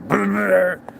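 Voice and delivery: high rolling engine blat with the mouth; imitated truck engine. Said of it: "you can hear my fucking truck going".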